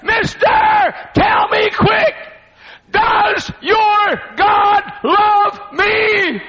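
high, loud, slow pleading shout; desperate scream. Retold or imitated. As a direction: scream it out begging for an answer. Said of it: "And then she screamed".

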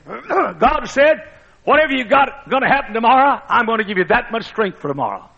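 high, ringing preacher's voice; preaching at full force. Acted. Preacher voicing God's promise, high and ringing. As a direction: proclaim it high.

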